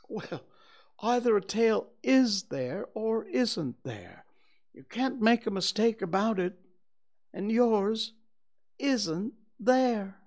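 a friendly, eager little bear; cheerful bear Pooh. Acted.

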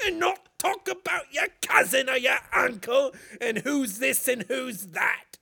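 exasperated British rant, hammed up. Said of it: "Can we do my British voice again?".

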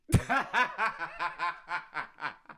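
big burst of laughter; real laughing fit; loud laugh. Real, not acted.

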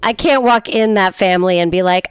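ordinary delivery

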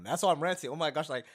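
overflowing with real excitement; gushing, can't hold it in; excited rant. Real, not acted. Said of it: "I'm ranting 'cause I'm so excited".

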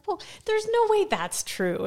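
high, slow disbelief; incredulous. Retold or imitated. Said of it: "And I was like".

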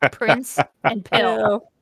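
"Prince and pill" split slowly into two words. Shown as a demonstration. Host mocking the fanfic spelling, slow and deliberate. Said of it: "those two words smashed together".

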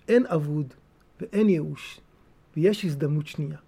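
soft consoling promise; reassuring. Acted. Gentle reassuring answer.

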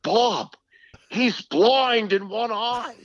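high, slow Boston accent impression; Ken Beatrice Boston accent. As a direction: stretch it out in a thick Boston accent. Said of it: "he had this thick Boston accent".

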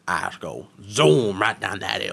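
a hint of a Cajun accent. Quoting coworker's hill answer in a mild Cajun accent. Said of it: "borderline Cajun accent".

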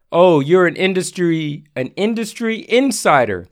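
ordinary talk, nothing marked

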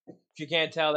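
usual speaking voice

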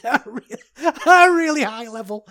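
real laugh breaking into the words, pitch jumping high. Real, not acted. Podcaster laughing at his own armchair coaching, laughing. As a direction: crack up mid-phrase, voice shooting up.

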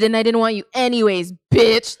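hammed-up angry shouting; hostile insults. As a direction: hurl each insult like a spurned man. Imitating rejected men hurling insults.

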